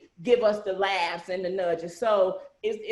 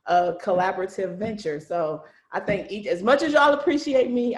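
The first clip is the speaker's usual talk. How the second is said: normal talking voice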